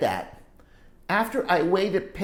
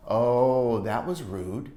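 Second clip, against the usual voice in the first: ordinary delivery, nothing marked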